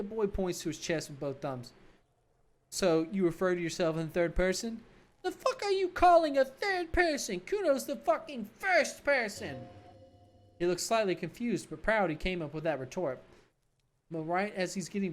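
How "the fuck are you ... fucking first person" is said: high, slow, sneering brat voice; bratty kid Kuno. Acted. Cocky kid mocking the cop, in a high bratty voice.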